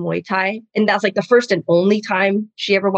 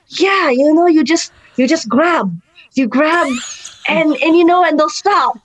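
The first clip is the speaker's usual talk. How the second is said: higher-pitched, insistent little auntie; old lady; 6 semitones higher, 3 dB louder than usual. Retold or imitated. Old mom explains a dirty grab, impersonated. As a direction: imitate a scheming grandma pressing on. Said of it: "she's an old lady".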